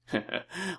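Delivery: short, quiet chuckle; acted chuckle. Captor amused at the prisoner's plea, quiet chuckle. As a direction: chuckle softly to yourself.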